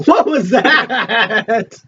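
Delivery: high, slow words shaking with laughter; laughing question. Real, not acted. Reacting to a noise, helpless laughter. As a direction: break into laughter while asking, stretched out.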